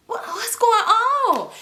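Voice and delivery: high, drawn-out confused question; acted bewilderment. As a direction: stretch it out high, utterly lost. Acting out her confusion.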